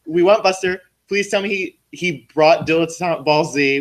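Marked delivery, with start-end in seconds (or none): none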